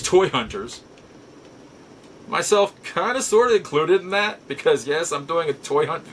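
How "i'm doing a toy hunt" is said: ordinary delivery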